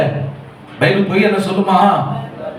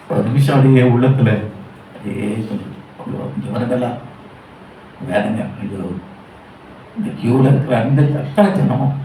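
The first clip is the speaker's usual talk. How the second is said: much lower and softer, an anguished murmur; a hushed lament over the crowd; grieving inner groan; 6 semitones lower, 3 dB quieter than usual. Retold or imitated. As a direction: groan quietly as if your heart aches. Inner groan watching the queue.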